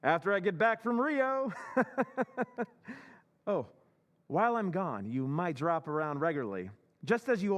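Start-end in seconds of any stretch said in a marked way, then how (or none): 1.6-2.6 gleeful laugh. Acted. Walter gloating about Rio.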